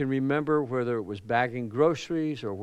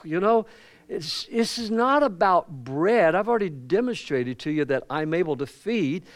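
preacher climbing into the story; fired-up preaching; 3 semitones higher, 3 dB louder than usual. Acted. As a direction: lift it into the pulpit voice. Preaching at higher pitch.

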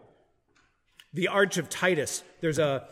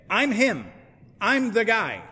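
boastful impostor; 5 semitones higher, 4 dB louder, slower than usual. Pretend. Voicing false messiahs claiming to be him.

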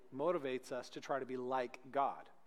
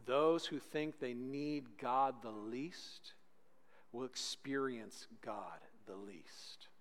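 dramatic sermon drop; 5 semitones lower, 9 dB quieter, slower than usual. Acted. Preacher lets the warning sink in, slow and fading. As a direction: let each phrase fall away, slowly.

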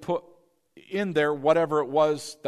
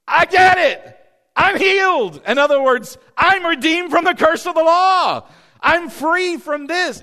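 high, loud, ecstatic shouting of healing; joyful scream; 13 semitones higher, 12 dB louder than usual. Retold or imitated. Healed woman running down the stairs screaming with joy. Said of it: "screaming down the stairs".

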